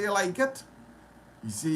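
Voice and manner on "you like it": eager offer. Retold or imitated. Retold dialogue giving chain away.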